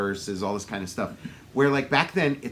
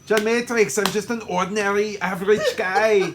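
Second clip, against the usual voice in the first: higher, louder mock Austrian accent; Schwarzenegger; 6 semitones higher, 4 dB louder than usual. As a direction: play it big with a comic Austrian accent. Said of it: "his character's name's John Matrix".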